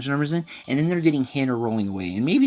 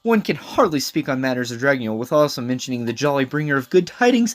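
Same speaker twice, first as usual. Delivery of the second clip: loud pompous laugh into grand speech; hammed-up royal herald, swelling at the end; Xander; 3 semitones higher, 6 dB louder than usual. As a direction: proclaim it with princely relish. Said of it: "a pompous prince".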